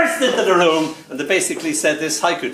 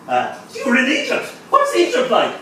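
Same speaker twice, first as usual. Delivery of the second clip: high-pitched, keen prodding; eager questioning; 10 semitones higher than usual. Acted. Asking non-committal brother about Egypt, eager high voice. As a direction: pester him with pitch way up.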